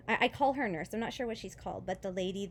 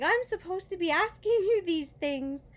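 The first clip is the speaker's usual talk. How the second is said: higher and louder, mock-scolding nurse; higher, louder playful nurse voice; amused nurse; 9 semitones higher, 7 dB louder than usual. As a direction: tease her like a nurse who's the one meant to ask.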